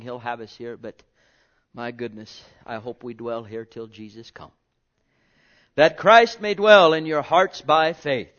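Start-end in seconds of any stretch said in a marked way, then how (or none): 5.7-8.4 loud, slow, ringing scripture reading; preaching scripture. Acted. Preacher proclaiming verse to congregation, loud and slow. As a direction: proclaim the verse slowly to the church.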